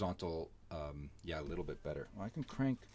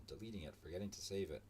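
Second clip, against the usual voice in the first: ordinary talk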